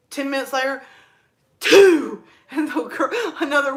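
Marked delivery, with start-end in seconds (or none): 1.6-2.2 sudden loud blast of a sneeze; reenacted sneeze. Sneezing beside coworker ten minutes later, reenacted blast. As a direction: burst out with a big mock sneeze.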